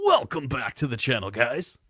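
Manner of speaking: put-on YouTuber intro voice; Mad Little Pixel. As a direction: imitate a channel-opening catchphrase. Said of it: "I think I can do his intro".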